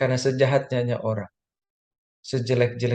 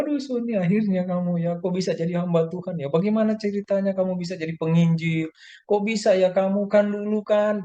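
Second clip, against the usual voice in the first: high-pitched imitation of astonished relatives; marveling relative, hammed up high; mock amazement; 9 semitones higher than usual. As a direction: gush in a much higher, put-on voice. Imitates relatives amazed at his conversion.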